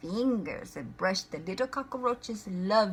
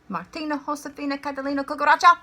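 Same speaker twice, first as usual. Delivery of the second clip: rattled off; 5 semitones higher, 6 dB louder, much faster than usual. Acted. Rattles off full name.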